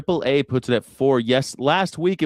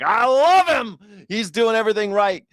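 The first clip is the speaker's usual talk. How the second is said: gushing Trump fan; 5 semitones higher than usual. Retold or imitated. MAGA fan praises Trump, high mock adoration. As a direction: gush like a blind devotee. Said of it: "maggers are like".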